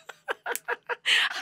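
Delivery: breathy high giggle bursting out; high laugh. Real, not acted. Host recalls Doctor Laura scolding a caller, giggling high. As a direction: laugh at the memory, high and unvoiced.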